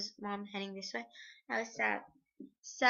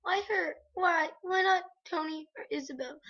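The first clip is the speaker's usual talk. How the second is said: crying; 9 semitones higher, 6 dB louder than usual. A narrator's reading. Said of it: "I started to cry".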